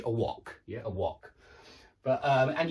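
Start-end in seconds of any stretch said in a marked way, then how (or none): 0.0-1.3 British "wok" with a short rounded o; lower, softer British "a wok"; British pronunciation. Shown as a demonstration. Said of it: "But in British English".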